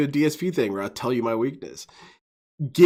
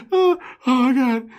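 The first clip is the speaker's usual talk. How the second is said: laughing; 8 semitones higher than usual. Real, not acted. Reacting to funny clip.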